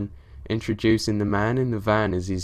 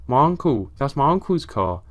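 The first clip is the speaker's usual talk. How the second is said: raised, higher-pitched call; shouted out; 4 semitones higher, 4 dB louder than usual. A narrator's reading. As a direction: call out on spotting help. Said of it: "shouted out".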